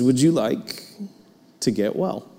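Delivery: soft and low, "well" dropping away; gentle question. Shown as a demonstration. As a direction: ask it tenderly, not as a test. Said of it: "But Jesus doesn't ask that".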